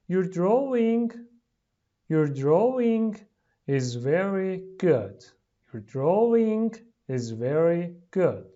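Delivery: slow phrases with pauses for repeating. Shown as a demonstration. Teacher modelling lines for pupils to repeat, slowly. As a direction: read it slowly, piece by piece.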